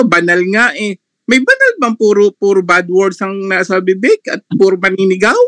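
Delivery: ordinary talk, nothing marked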